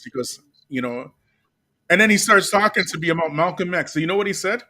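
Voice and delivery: ordinary talk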